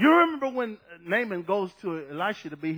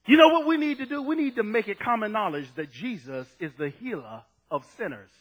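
ordinary talk, nothing marked

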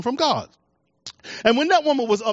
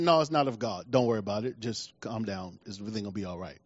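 much lower and softer, soothing, settling a worried friend; calm reassurance; 11 semitones lower, 9 dB quieter than usual. Retold or imitated. Pastor calming a worried caller on the phone, soft and soothing. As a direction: talk them down gently, voice sinking low. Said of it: "I said".

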